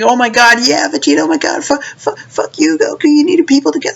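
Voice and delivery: very high, squealing fanboy rant; mocking rabid fans. Mocking Vegeta fans sneering at Goku, high squealing voice. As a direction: squeal it as a silly gushing fan.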